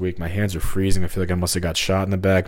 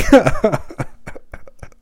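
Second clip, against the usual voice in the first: high breathy laugh; 13 semitones higher, 4 dB louder than usual. Real, not acted. Laughs at own slip.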